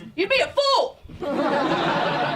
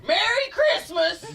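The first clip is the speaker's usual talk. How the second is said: angry Merry Christmas; 8 semitones higher, slower than usual. Retold or imitated. Mad dad yelling holiday wishes, angry shout. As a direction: bark the greeting like it's a threat. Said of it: "dad has been mad for so long".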